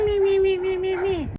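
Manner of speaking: high yappy little barks; dog yapping imitation. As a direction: yap like two small dogs. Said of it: "they're just meh".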